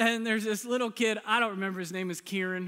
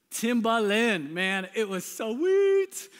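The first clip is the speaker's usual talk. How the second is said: loud, slow, stretched-out gushing; big drawn-out bragging about prized gear; relishing boast; 3 semitones higher, 7 dB louder, much slower than usual. Real, not acted. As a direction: savor each word, drawing it way out. Gushing over his prized windbreaker.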